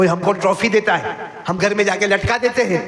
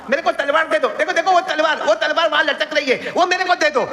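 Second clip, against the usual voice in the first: Hussain asking urgently; 11 semitones higher than usual. Acted. Hussain asks for the sword.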